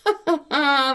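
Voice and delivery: high impish cackle in a strange voice; cheeky laugh. A narrator's reading. Sin and Unbelief laugh at the envoy, cheeky cackle. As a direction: laugh at him, cheeky and mocking. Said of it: "lachten Sünde und Unglaube frech".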